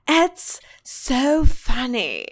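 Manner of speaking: normal talking voice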